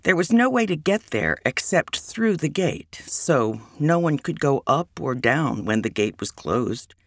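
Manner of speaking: ordinary delivery, nothing marked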